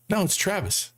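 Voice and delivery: high-pitched insistent retort; emphatic correction. Retold or imitated. Acts out friend's emphatic answer.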